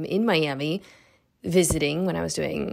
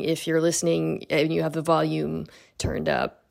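ordinary talk, nothing marked

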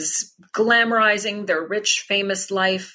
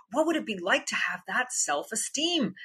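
Celine-style musing; 4 semitones higher, 7 dB quieter than usual. Retold or imitated. Voicing [name] character.

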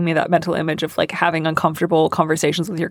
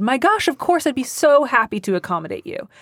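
higher-pitched imagined happy consent; delighted agreement; 5 semitones higher than usual. Pretend. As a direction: play the neighbour gladly saying yes. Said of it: "act as if she has already said".

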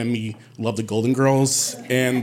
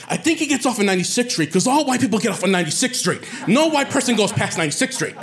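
put-on higher, fast gossipy girl voice; mocking chatty girl; 8 semitones higher, faster than usual. Girl guessing his subway stop, high and rapid. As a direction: rattle it off like a nosy stranger.

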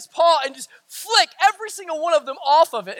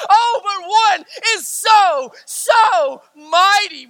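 high, raised voice building to a shout; full-force preaching; 5 semitones higher, 3 dB louder than usual. Real, not acted. Preacher urging brothers and sisters to see the difference, impassioned shout. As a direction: build each contrast to a ringing peak.

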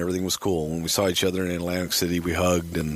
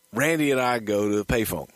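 ordinary delivery

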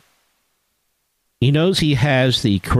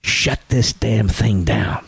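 whisper yelling; 7 semitones lower, slower than usual. Retold or imitated. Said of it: "I whispered to Nancy".

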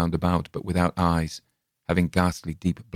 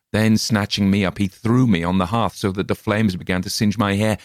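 plain reading voice

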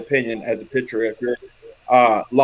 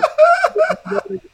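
loud high laugh; 4 dB louder than usual. Real, not acted. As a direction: burst of shouted laughter. Laughing at the story.

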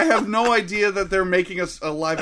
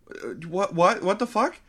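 usual speaking voice

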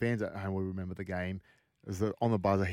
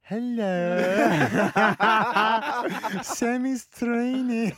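much higher, laughing mock of the auntie; auntie covering, laughing; 13 semitones higher, 8 dB louder, much slower than usual. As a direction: squeak it out through laughter. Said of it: "And then he goes".

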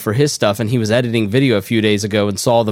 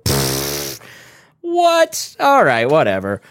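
mock dismissive shrug; 2 semitones lower, much slower than usual. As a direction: brush it off, unbothered. Mocking dismissive audience.

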